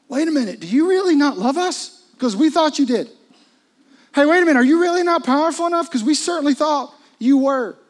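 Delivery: loud, high, rushing panic; high loud frantic pleading; panicked disciples. Retold or imitated. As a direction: cry out in a frantic rush to the master. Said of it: "And that's why he stood up".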